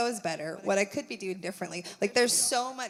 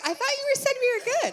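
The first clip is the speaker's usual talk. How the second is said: much higher mocking voice, pitched up; mock whiny self; 6 dB louder, much faster than usual. Teasing her husband about her own doubt, in a high mock whine. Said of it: "and then I'm the one that's like".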